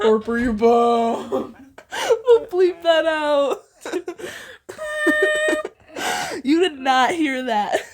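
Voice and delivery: slow, loud, breaking into laughter; can barely talk for laughing; real giggling fit; laughing through words. Real, not acted. Cracking up over a slip.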